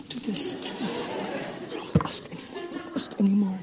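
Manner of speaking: breathy, hushed self-scolding; mock whispered resolve. Believer whispering promises to himself, hushed mock resolve. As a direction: whisper the rules to yourself.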